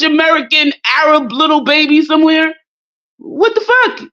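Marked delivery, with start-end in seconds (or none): 3.2-4.1 high-pitched incredulous "what the fuck"; high disbelief. Real, not acted. Reacting to the mixed baby, high and shocked. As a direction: squeal it in shock.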